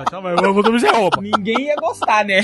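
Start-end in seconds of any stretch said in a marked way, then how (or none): 0.0-1.2 lightly mocking low motor voice; low comic impersonation; engine-regulator voice. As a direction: parody her in a deeper, droning voice. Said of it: "Com essa voz de regulador".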